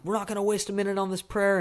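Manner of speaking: mock yelling, pushed up in pitch. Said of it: "yelling at his son".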